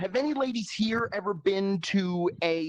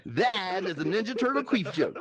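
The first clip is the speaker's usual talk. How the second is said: usual speaking voice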